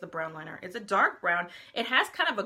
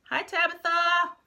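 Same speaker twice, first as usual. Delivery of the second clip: excited greeting; 9 semitones higher, 7 dB louder, slower than usual. Real, not acted. Greeting a viewer.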